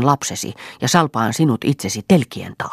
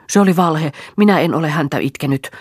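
higher, louder snapping denial; angry snap; 4 semitones higher, 5 dB louder than usual. A narrator's reading. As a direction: snap it back, stung. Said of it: "tiuskaisi Marja".

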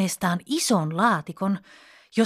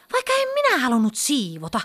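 fast, higher grumpy huff; 6 semitones higher, faster than usual. A narrator's reading. Pippa huffing to the wasps about tidying, sulky. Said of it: "Pippa puuskahti".

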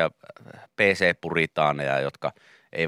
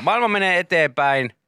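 high, slow mock-grand proclamation; mock solemn decree; 7 semitones higher than usual. Radio host mock-lecturing PC gamers, slow and grand.

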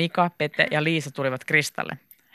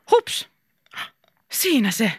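high-pitched little cry; quick, high exclamation; sudden exclamation; 6 semitones higher, slower than usual. A narrator's reading. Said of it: "huudahti Krista".

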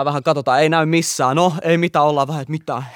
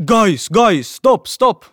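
urgent raised-voice shout, high and loud; higher, louder imitation of a man shouting; retold shout; 6 semitones higher, 5 dB louder, much slower than usual. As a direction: call out sharply to stop someone. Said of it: "Ja sit se huutaa jotain".

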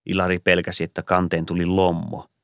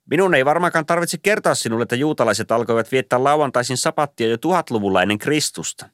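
much higher, lecturing tone; pedantic Santa; 6 semitones higher than usual. A narrator's reading. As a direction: lecture him condescendingly. Pukki lecturing about sabbath.

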